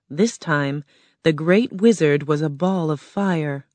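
plain reading voice

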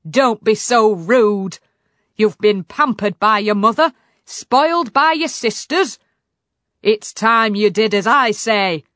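loud, stern telling-off; shouting father. A narrator's reading. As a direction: scold a rude daughter loudly. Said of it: "shouted her father".

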